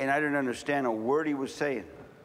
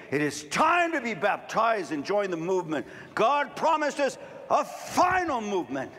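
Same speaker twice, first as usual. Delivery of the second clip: full-force preaching; 7 semitones higher than usual. Acted. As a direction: thunder it at the congregation. Altar call, preaching.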